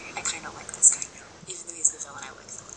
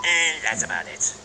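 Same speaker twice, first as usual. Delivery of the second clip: silly voice; 9 semitones lower than usual. Acted. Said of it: "I'm still doing that silly voice".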